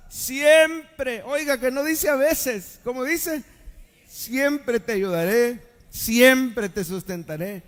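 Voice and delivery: voz alta y fuerte, alargando cada «siempre»; prédica enérgica. Acted. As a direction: recalca el versículo ante la congregación. Preaches the verse at full force. (proclamación enfática y sostenida)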